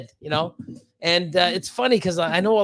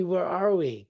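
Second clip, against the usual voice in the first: ordinary delivery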